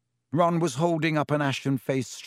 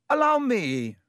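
high, loud eager offer; shouted offer; 10 semitones higher, 3 dB louder than usual. A narrator's reading. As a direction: leap in showing off. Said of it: "shouted Lockhart".

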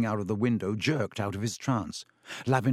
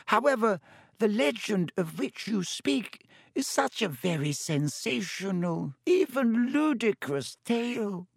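Professor Binns, wheezy disdain; 9 semitones higher than usual. A narrator's reading. Ghost teacher dismissing the legend to his class, high and wheezy. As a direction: wheeze disdainfully, stretching the last words.

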